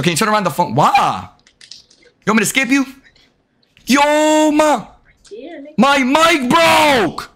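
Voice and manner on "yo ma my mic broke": high, drawn-out holler; real shout to mom. Real, not acted. Streamer calling his mom about his broken mic, upset shout. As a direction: shout it across to your mother.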